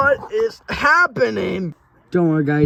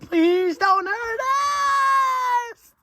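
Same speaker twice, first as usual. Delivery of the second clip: desperate plea; 12 semitones higher than usual. Acted. Begging the monster.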